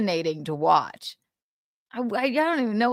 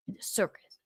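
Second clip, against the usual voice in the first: whispering; 9 dB quieter, faster than usual. Real, not acted. Whispered repeat.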